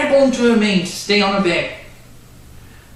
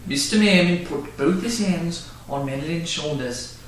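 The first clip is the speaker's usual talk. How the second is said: ordinary delivery, nothing marked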